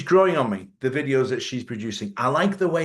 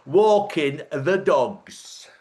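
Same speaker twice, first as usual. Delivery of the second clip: mock northern drawl; 2 semitones higher, 5 dB louder, much slower than usual. Mimicking the couple again.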